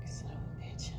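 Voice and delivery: under-the-breath whisper; a bitter whispered curse. Real, not acted. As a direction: hiss it under your breath. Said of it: "whispered curse".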